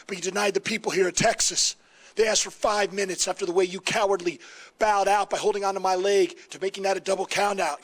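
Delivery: ordinary delivery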